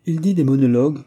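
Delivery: resume the list firmly on "Il dit des monologues"; counting off. A narrator's reading. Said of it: "reprit-elle en comptant sur ses doigts". (« Il dit des monologues » énuméré, plus fort et aigu)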